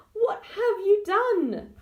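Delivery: high, loud and very slow; dismayed. A narrator's reading. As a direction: drag it out in disbelief. Daughter dismayed at the flood.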